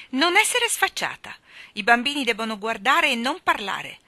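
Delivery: high, loud, indignant reprimand; sharp scolding. A narrator's reading. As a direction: put a cheeky child in her place loudly. Woman scolds the girl for answering. (rimprovero acuto e indignato)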